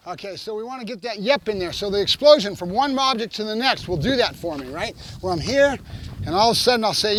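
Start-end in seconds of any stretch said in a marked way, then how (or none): none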